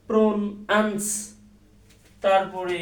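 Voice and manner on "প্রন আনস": "প্রন আনস" as two separate chunks, pron and ans; wrongly split word. Shown as a demonstration. Teacher trying a learner's own way of splitting it, detached chunks. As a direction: sound out "প্রন আনস" in detached, even pieces. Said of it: "এইভাবে উচ্চারণ করেন".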